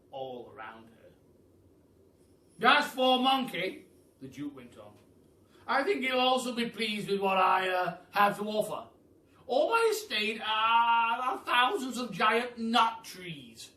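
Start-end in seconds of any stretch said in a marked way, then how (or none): 2.7-3.8 loud, grand aristocratic Duke, higher; booming Duke. Acted. The Duke welcomes the hungry giraffe, booming and grand. 5.6-13.7 loud, grand aristocratic Duke, higher; booming Duke. Acted. The Duke welcomes the hungry giraffe, booming and grand.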